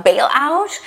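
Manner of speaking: ordinary delivery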